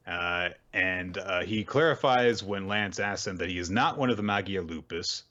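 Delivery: normal talking voice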